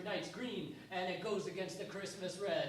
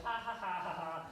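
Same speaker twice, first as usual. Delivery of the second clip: taunting laugh. Retold or imitated. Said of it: "taunting him".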